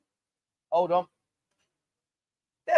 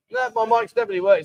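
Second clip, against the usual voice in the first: exasperated insistence; 4 dB louder, faster than usual. Real, not acted. Insists mic works.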